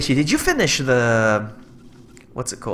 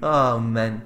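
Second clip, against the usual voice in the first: ordinary talk, nothing marked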